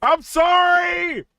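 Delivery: drawn-out high laughing shout; mock apology shout. Real, not acted. To viewers, a drawn-out laughing shout of sorry. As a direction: holler sorry through laughter.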